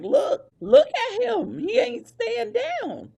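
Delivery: high, loud, slow awed whisper-shout; stunned sidekick pointing at a survivor; amazed urging. Retold or imitated. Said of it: "Jay taps Roman and is like".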